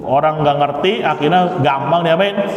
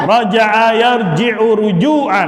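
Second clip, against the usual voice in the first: recitation drill; 5 semitones higher than usual. Shown as a demonstration. Teacher reciting the masdar gallery aloud, chanting. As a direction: recite each pattern clearly for the class.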